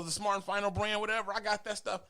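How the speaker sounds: usual speaking voice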